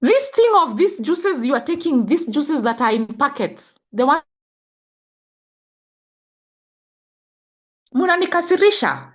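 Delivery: getting emotional. Real, not acted. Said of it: "Now I'm getting emotional again".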